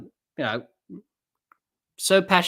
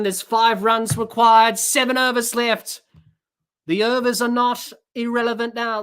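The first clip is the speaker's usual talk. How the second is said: high, loud, racing cricket call; breathless live commentary, much higher; excited play-by-play; 5 semitones higher, 4 dB louder than usual. Real, not acted. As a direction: call the boundary like the crowd's on its feet. Calls the next ball live.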